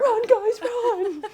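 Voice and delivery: high, loud laughing yell; panicked shout. Pretend. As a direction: yell at them to flee. Pretend panic on a hike.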